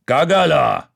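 high, loud bark; gruff insistence. Acted. Hairy barman insisting at the table, loud bark. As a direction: bark the word as a threat.

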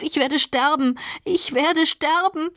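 high, desperate shriek. A narrator's reading. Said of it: "kreischte sie". (verzweifelt kreischend)

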